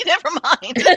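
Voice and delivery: laughing. Real, not acted. Player doubting her own remark about being dead, laughing. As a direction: give up on the sentence and crack up.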